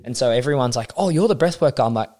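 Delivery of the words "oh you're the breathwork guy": mock fan greeting. People calling him that, mock.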